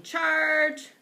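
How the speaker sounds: high, loud, held-out battle cry; battle yell. A narrator's reading. Hamster running at the food bag, yelling. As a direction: yell it as you charge.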